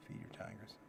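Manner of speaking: breathy whispered heading. Shown as a demonstration. Giving listeners the next tip in a whisper. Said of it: "repeats heading whispered".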